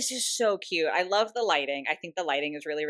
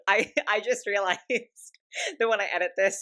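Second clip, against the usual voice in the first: giggling; 3 semitones higher, 4 dB louder than usual. Real, not acted. Giggling mid-thought.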